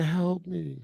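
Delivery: small, high, soft plea; imitated woman's voice. Unseen woman calling from the background, soft imitated plea. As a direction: imitate a faint woman's or child's cry from afar. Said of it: "a woman's voice".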